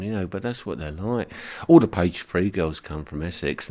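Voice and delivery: usual speaking voice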